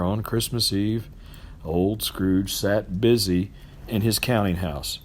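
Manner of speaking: plain reading voice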